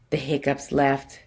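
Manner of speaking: plain reading voice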